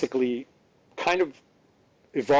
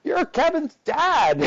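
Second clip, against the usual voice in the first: high, drawn-out crowd yell; stretched, high-pitched mock holler; imitated yell; 5 dB louder, slower than usual. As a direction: call it out loud and slow like a fan spotting someone. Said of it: "and somebody yells".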